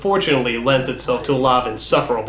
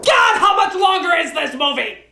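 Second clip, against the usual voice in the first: exasperated shouting. Real, not acted. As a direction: shout it in disbelief, near laughing. Exasperated rant at long movie.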